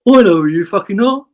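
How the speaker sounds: Glenn's snappy put-down. Retold or imitated. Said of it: "he said".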